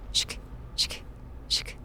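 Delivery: soft voiceless shk shk like a blade scraped on stone; imitated blade sharpening. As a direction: whisper the scrape of metal on stone to spook the listener. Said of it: "Metal whispering on stone".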